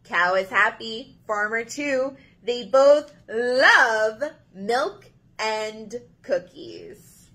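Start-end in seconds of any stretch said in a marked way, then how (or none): none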